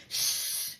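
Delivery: long, voiceless "shh"; hushing shush. Retold or imitated. Hiding in the boot with her friend, urgent shush.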